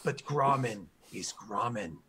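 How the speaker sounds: slow broken-grammar orc boasting of his hero; proud orc guard. Acted. Orc guard proudly tells the party who Grommen is, slow boasting. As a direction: puff up and drag out each boast like a devoted grunt.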